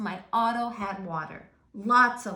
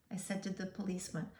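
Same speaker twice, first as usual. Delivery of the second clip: ordinary delivery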